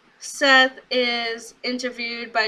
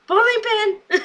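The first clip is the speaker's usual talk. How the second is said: BOWLING PIN exclaimed very high; high-pitched imitated outburst; indignant mock shout; 11 semitones higher, 4 dB louder, slower than usual. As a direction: echo the insult in a high, hammed-up cry. [name]'s outraged echo of the insult.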